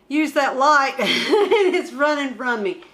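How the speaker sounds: laughing at the light that keeps slipping away. Real, not acted. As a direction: talk through laughter.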